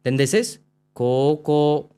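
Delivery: कोको said slowly with both vowels stretched long; long vowel demo. Shown as a demonstration. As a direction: draw out the o in कोको. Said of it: "long vowel".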